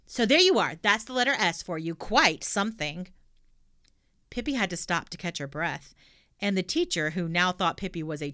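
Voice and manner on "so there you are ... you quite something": put-on loud, bold little girl; cheeky girl voice. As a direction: blurt it out brashly. Pippi finishes tale.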